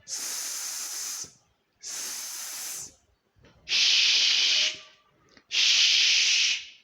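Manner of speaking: long unvoiced "S" and "Sh" noise; isolated voiceless consonants. Shown as a demonstration. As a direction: hold "S" and "Sh" on breath alone. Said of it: "The voiceless consonant sounds".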